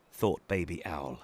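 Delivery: ordinary delivery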